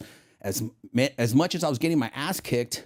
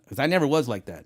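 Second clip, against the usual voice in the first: ordinary talk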